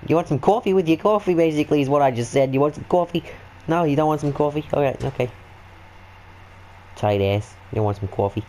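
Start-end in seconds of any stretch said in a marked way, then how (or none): 0.1-5.3 put-on New York accent. Fake New Yorker offering coffee.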